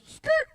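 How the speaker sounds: very high squeaky skrrt; a quick tire-screech noise; skidding sound effect. Retold or imitated. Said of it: "And the guy goes".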